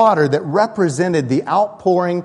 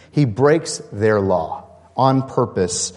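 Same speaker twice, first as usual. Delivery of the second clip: normal talking voice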